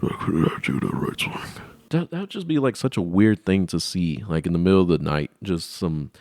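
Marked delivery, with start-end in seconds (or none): 0.0-1.4 hushed, low whisper. Acted. Falling man cursing, whispered. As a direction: breathe it out quietly.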